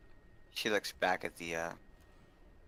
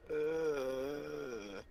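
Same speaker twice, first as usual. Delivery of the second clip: pained groan; 8 semitones higher, 3 dB quieter than usual. Acted. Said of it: "literally laying there going".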